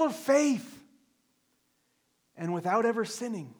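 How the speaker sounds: high, loud, slow preaching climax; fervent preaching. Acted. Pastor proclaiming grace to his congregation, fervent and slow. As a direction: lift each phrase high and drawn out, pleading.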